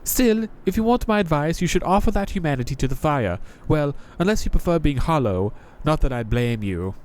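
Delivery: a jaded knight greeting a newcomer; Crestfallen Knight voice. Acted. Knight gives advice.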